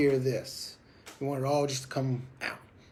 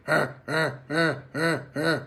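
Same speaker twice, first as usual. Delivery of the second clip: short breathy huh huh bursts; buzz like an alarm clock; slower than usual. Shown as a demonstration. Goose-calling instructor demoing the alarm-clock buzz, breathy huffs. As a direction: mimic a goose buzz call. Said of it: "sound like they're alarm clock".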